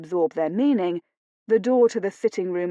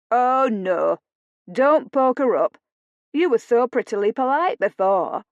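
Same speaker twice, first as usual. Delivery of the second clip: louder, broad Yorkshire teasing; teasing Yorkshire visitor; 4 semitones higher, 5 dB louder than usual. A narrator's reading. Visitor laughs off the lady's coolness, teasing Yorkshire accent. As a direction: chide her playfully in heavy Yorkshire.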